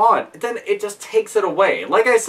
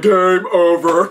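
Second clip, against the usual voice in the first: game announcer; 4 dB louder, slower than usual. Retold or imitated. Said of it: "I'll be like".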